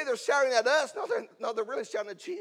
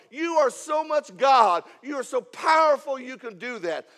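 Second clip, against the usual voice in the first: loud, raised preacher's plea, building in force; impassioned pleading; 4 semitones higher, 7 dB louder than usual. Acted. Mother begging Jesus to heal her daughter, impassioned. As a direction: plead at full force, leaning on each phrase.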